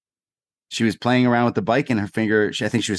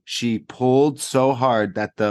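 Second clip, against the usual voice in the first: ordinary talk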